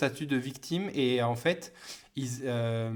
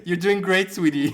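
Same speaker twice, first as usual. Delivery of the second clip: playful teasing; 5 semitones higher, 8 dB louder than usual. Pretend. Teasing her friend with a meme.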